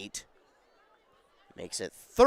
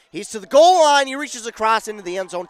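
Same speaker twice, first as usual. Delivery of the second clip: much higher and louder burst at the goal line; touchdown call; 6 semitones higher, 11 dB louder than usual. Real, not acted. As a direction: shout the touchdown as it happens.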